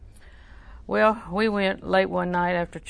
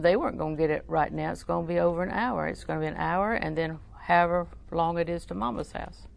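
normal talking voice

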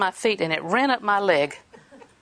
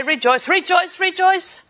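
rising joyful proclamation; 8 semitones higher, slower than usual. Acted. To the congregation, a rising joyful call to rejoice. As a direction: call it out, climbing with joy.

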